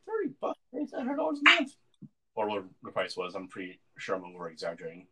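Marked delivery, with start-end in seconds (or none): 0.0-1.8 high, squeaky mocking salesperson voice; mock sales pitch. Retelling the timeshare pitch to a friend, in a high mocking salesperson voice. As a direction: parody the pushy pitch, much higher.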